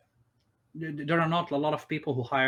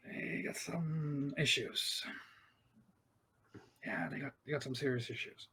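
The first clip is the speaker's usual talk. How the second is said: hesitant, tactful; 10 dB quieter than usual. Acted. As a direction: choose the words gingerly, trailing into a soft admission. Tactfully criticising a religious group.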